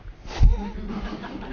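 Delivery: short breathy rueful laugh. Real, not acted. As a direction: laugh at your own predicament. Laughs at editor's refusal.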